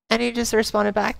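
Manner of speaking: ordinary talk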